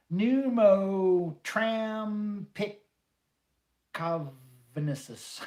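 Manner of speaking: slow, loud, stretched-out attempt at a long word; mangled shout. Shouting the word a viewer asked for, slow and loud. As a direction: drag every syllable of pneumotrampicovenicis out loud and slow. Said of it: "I'd have to shorten it to".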